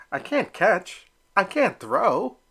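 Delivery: whiny protest. Acted. Elmer protests to Papa.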